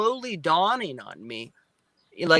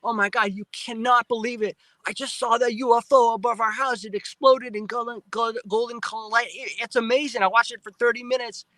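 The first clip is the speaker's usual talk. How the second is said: higher, bubbling retold excitement; excited phone call; 5 semitones higher than usual. Calling his mom to share the UFO news, excited and higher. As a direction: gush the news, pitched up. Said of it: "I'm excited to tell her".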